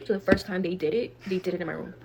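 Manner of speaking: ordinary talk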